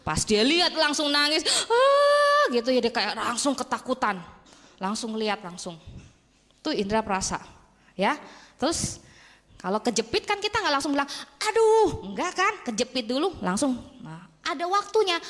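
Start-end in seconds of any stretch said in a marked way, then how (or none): 1.7-2.5 high loud crying wail in imitation; mock crying wail. Retelling a friend's panic, a high imitated crying wail. 11.4-12.1 high, loud mock ouch; mock pain cry. Acting a pinched finger for listeners, high loud mock ouch.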